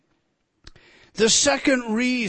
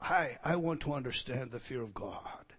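much softer, almost whispered quote; hushed retold plea; 5 semitones lower, 9 dB quieter than usual. Man telling the preacher his wish, softly murmured. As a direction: murmur the man's words under the breath. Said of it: "I had a man once say to me".

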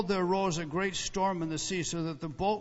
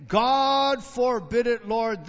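loud, slow, high protest; 6 semitones higher, 10 dB louder, much slower than usual. Acted. Peter protesting to Jesus, loud and high. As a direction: cry it out in alarm.

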